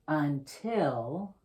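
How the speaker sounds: ordinary delivery, nothing marked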